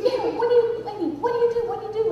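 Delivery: high, urgent seatmate imitation; high-pitched insistence; desperate questioning. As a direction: mimic a frantic man pressing for an answer. Seatmate desperately pressing for small talk.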